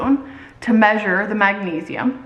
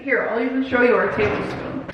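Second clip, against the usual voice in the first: ordinary talk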